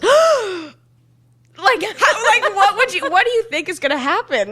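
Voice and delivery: high, loud, startled disbelief; gasp, then ask in shock; shocked high exclamation. Retold or imitated.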